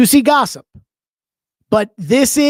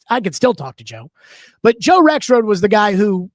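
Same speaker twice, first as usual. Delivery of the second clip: normal talking voice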